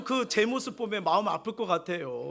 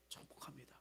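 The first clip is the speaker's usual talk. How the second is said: 축복합니다 repeated much softer, almost under the breath; soft echo; 6 semitones lower, 23 dB quieter than usual. Shown as a demonstration. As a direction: let 축복합니다 fall away quietly. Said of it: "꼭 축복합니다".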